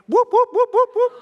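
high, loud siren whoops; imitated police siren. Police pulling a driver over, imitated siren. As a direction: mimic a squad car's short bursts.

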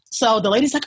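ordinary talk, nothing marked

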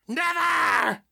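piercing high shriek. A narrator's reading. Janet defying the preacher with a shriek. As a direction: shriek it defiantly. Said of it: "Janet shrieked".

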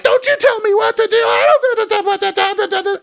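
very high, shrieking mock scream; retold screaming. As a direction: imitate her yelling back, then trailing off into nonsense. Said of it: "she's screaming at him".

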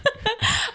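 a sudden high, loud wail of a laugh; high squealing laugh-cry. Real, not acted. Overcome while recalling the dog movie, squealing laugh-cry. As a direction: burst into a squealing half-sob half-laugh.

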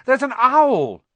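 high, very loud warning; loud alert. A narrator's reading. Harry alerting sleeping Hagrid, loudly. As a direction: shout the news to wake him. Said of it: "said Harry loudly".

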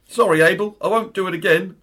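put-on Cockney accent. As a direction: apologise like a Cockney lad. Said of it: "Cockney accents for this".

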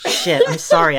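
ordinary talk, nothing marked

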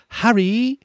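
put-on a man putting on a high young girl's voice; Jim Dale as Hermione. Mimicking the narrator voicing Hermione, high falsetto. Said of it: "It's like Jim Dale when he talks as Hermione".

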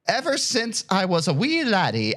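swooping mock Irish lilt; pseudo-Irish accent. Podcaster reading a listener's question as written, mock Irish. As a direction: ham up an Irish brogue. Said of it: "pseudo-Irish speech".

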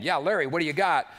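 God picks up Larry's call like a cheerful manager; God answering. Retold or imitated. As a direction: take the call like a busy boss. God voiced answering a prayer call.

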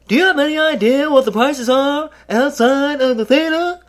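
high, loud, whiny Seinfeld squeal; terrible Seinfeld impression. Comic at the theater prices, doing a bad Seinfeld squeal. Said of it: "That was a terrible Seinfeld impression".

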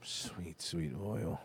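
very soft, low enticement; mock seductive cooing. Host enticed by talk of oil, mock seductive hush. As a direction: coo it low, almost under the breath.

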